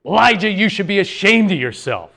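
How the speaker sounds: acted stern rebuke; reprimand. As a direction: shame him with a sharp telling-off. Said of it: "Instead of reprimanding Elijah".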